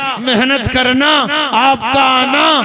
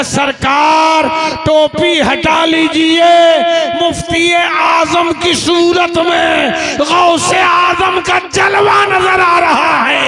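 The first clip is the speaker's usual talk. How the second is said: high, slow, loud cry of awe; slow, loud, awestruck disciple's cry; retold cry; 6 semitones higher, 5 dB louder, much slower than usual. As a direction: shout it out as the overwhelmed disciple. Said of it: "अब वो चीखने लगा".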